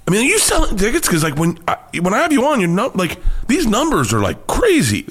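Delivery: high, amazed mock Joe; imitating Joe. Joe marvelling at the numbers, high mock voice. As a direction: squeak up high like Joe in disbelief. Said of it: "He goes".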